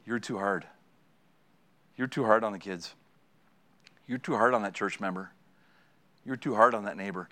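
low, soft and measured, as the wife; tender, hushed correction; gentle wifely reproof. Retold or imitated. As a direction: quietly chide a loved one. Wife gently correcting her gruff husband.

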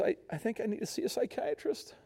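high-pitched imitation of a weepy dad; hammed-up fragile confession; mock tearful dad. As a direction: say it high, as if fighting tears. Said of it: "I can't stop crying".

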